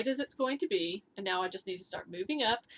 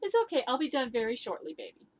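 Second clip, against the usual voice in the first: high, louder reassuring coo; soothing the dog; 4 semitones higher, 4 dB louder, faster than usual. Real, not acted.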